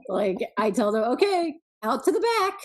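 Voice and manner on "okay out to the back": put-on higher, louder call to the kids, laughing. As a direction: holler them out the back door. Calling kids outside.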